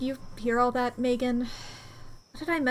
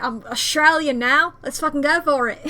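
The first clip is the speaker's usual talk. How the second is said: put-on loud, wobbly stab at an Australian accent; badly faked Australian accent; botched Australian accent; 3 semitones higher, 7 dB louder than usual. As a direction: attempt Australian and miss it gleefully. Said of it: "this is also not what an Australian accent sounds like".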